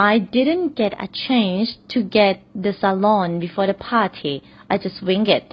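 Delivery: very slow, careful read; slow example sentence. Shown as a demonstration. Teacher reads the salon example slowly to learners. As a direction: read it slowly so students follow. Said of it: "ขออีกนิดนึง".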